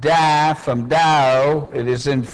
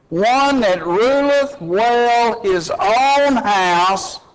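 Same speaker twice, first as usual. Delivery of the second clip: higher, impassioned pulpit voice, reading the qualifications; fiery preaching; 5 semitones higher than usual. Acted. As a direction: build the sermon, drive each line home. Preaching, raised voice on scripture.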